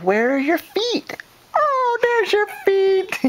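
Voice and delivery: high excited sing-song; light up with delight. Real, not acted. Mother finds baby's feet, high delighted baby talk.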